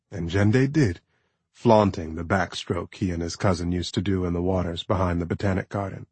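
ordinary delivery, nothing marked

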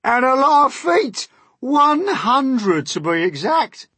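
very high, loud bragging; proud centipede. A narrator's reading. Centipede boasting to James of feet, proud. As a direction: trumpet each phrase proudly. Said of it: "the centipede answered proudly".

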